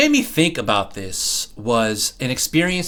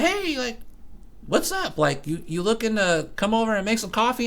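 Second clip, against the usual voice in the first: higher, softer friendly coworker; sweet coworker voice; 8 semitones higher, 5 dB quieter than usual. Retold or imitated. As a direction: lift it up light and sweet. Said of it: "she's like".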